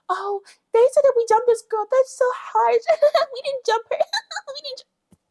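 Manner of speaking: very high, giggling put-on girly voice; giggly mock confessional. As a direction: fake innocence through giggles.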